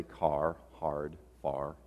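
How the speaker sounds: "car", "hard", "far" with non-Boston Rs, spaced out; R pronounced in car, hard, far. Shown as a demonstration. Preacher shows he lost his Boston accent, sounding R in "car, hard, far". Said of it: "I've learned how to say".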